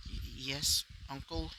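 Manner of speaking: soft, hesitant reply with a stammer; low voice. Acted. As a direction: answer quietly, stumbling on the first word. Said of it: "say it in a low, in a low voice".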